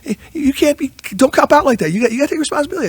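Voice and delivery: high-pitched, animated mock scolding; animated Monty Kiffin. Old coach chewing out his assistant, high animated scolding. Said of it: "Monty was always animated".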